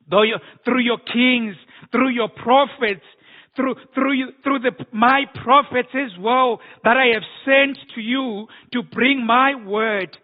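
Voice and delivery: impassioned pulpit voice speaking as God; preaching as God. Acted. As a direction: thunder the Lord's complaint from the pulpit. Preaching God's words.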